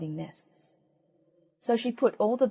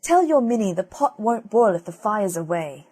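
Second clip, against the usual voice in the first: louder, scolding henwife; angry henwife; 2 semitones higher, 7 dB louder than usual. Acted. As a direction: tell her off sharply. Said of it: "the henwife was rare angry".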